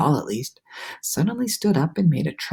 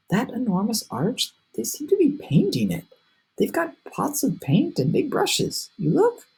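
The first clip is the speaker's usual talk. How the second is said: put-on higher boyish voice, eager; excited James; 7 semitones higher than usual. A narrator's reading. James telling friends the cloudmen are painting, eager. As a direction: point it out with wonder.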